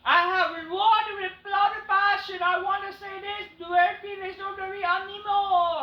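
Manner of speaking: high, slow, loud mock promo; hammed-up wrestler promo voice; imitating the wrestler. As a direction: overblown debut speech. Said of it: "he goes on to say".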